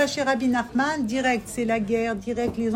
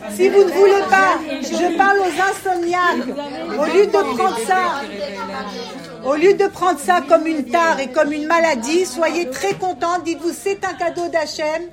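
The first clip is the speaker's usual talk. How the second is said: loud, high exhortation; fervent preaching; 5 semitones higher, 6 dB louder than usual. Acted. Teacher urging insomniacs to rejoice, fervently. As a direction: preach it to the whole room.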